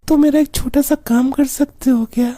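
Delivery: put-on high girlish phone voice; coy young woman voice; man doing a woman's voice. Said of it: "तुम्हारी आवाज तो बहुत अच्छी है".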